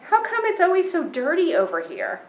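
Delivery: put-on a small boy wrinkling his nose at the street; high, puzzled child. A narrator's reading. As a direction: CJ asking Nana about the dirt, high and puzzled. CJ asks Nana.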